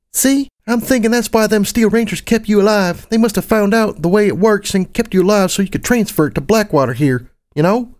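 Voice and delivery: put-on high-pitched child's voice; young Serenity explaining.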